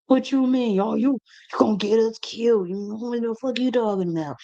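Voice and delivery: high, mocking voice of the doubters; mock the people who think she's crazy; mocking skeptics. Doubters scoff at her spiritual talk, high mocking voice. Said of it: "I sound like the crazy lady".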